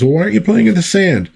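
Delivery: put-on mother asking kids. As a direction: chirp it like a puzzled mum. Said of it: "the wife of Rocket Red is asking the kids".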